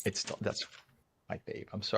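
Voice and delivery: choked-up near whisper; mock tearful, voice dropping; emotional. Real, not acted. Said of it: "I'm getting emotional right now".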